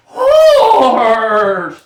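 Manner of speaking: loud whinnied "Horse!"; horse neigh. Pretend. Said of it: "A horse".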